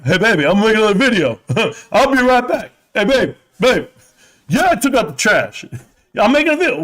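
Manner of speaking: high, loud, laughing brush-off; sheepish husband. Retold or imitated. Husband calling to wife while filming, high and laughing. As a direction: fob off his wife, giggling. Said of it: "And then there I am".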